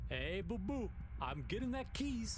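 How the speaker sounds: chirpy cartoon bear announcing; Yogi Bear voice. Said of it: "Yogi, Yogi".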